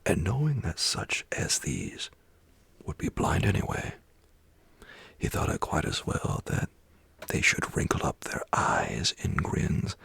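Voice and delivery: plain reading voice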